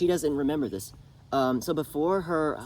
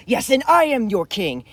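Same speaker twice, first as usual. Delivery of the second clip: much higher, louder royal snap; imperious retort; 9 semitones higher, 11 dB louder than usual. Retold or imitated. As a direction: put her in her place as king. King defying his mother.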